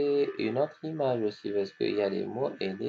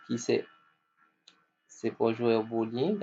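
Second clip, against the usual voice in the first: usual speaking voice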